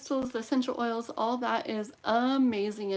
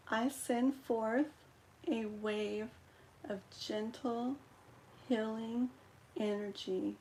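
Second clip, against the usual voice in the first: prayer recitation; 4 dB quieter than usual. Acted. Recites healing prayer over candle.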